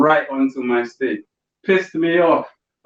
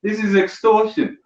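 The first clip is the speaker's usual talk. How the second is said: hammed-up outrage at a vending machine; mock outrage; 6 semitones higher than usual. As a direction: play shocked at the price. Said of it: "you're like".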